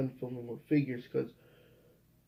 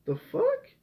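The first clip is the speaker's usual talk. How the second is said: very high disbelieving outburst; incredulous squeak; 3 dB louder than usual. Real, not acted. Vlogger reacting to Ruby's cold greeting, very high incredulous squeak. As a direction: shoot the voice way up in disbelief.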